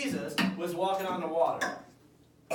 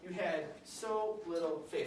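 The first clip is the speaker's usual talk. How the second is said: gentle rebuke; 6 dB quieter than usual. Acted. Teacher voicing Jesus gently rebuking Peter. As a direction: tell him gently he lacked faith. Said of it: "he says".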